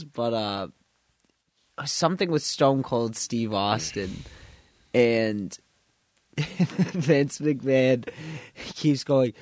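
ordinary talk, nothing marked

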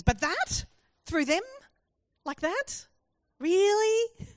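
high, loud disbelief, climbing to "Really?"; incredulous questioning. Acted. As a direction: challenge God in open disbelief. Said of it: "There is so much emotion in what Habakkuk is asking".

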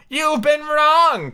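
high, loud, drawn-out Tweedledum; loud storybook-twin protest; crying out. A narrator's reading. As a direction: cry it out high, stretching the words. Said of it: "cried Tweedledum".

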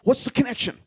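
mock puzzled outburst; baffled huh. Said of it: "And I was like".

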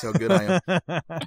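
amused, laughing through the words. Real, not acted. Podcaster laughing at his own accidental pun, laughing.

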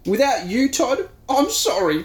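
high weepy whine. Acted. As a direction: sniffle it out sadly. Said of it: "Todd weeps".

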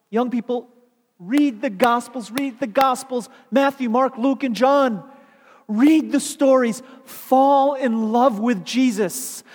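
loud, rising preacher's exhortation; preaching at full force. Acted. Preacher rousing young listeners, loud and fervent. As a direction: build the sermon to a loud climax.

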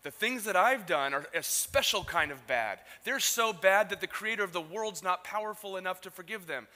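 loud, scornful self-pity; loud indignant quote. Retold or imitated. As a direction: proclaim it with wounded pride. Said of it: "what you're saying is".

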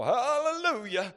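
high, loud preacher's cry; preachy hallelujah. Acted. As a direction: belt it out like a fired-up preacher. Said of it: "I started getting all preachy on it".

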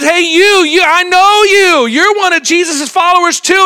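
high, loud accusing call; accusing servant girl. Retold or imitated. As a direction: point him out across the courtyard. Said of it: "and she says".

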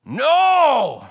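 put-on huge, high Scottish-accented shout of refusal. Old Scot shouting down the preacher in a Scottish accent. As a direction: bellow the no like an outraged Scot. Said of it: "I'm gonna do a Scottish accent here".

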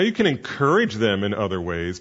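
ordinary talk

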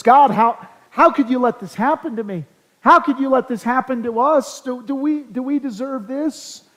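high, loud protest that fades to a doubtful question; mock-outraged prayer, starting loud and trailing off into doubt; indignant plea to God. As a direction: play the aggrieved sufferer accusing God. Imagined complaint to God.

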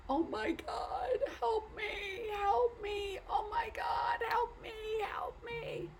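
high, strained, drawn-out wail from afar; desperate cry for help. Acted. Man hanging from branch cries out.

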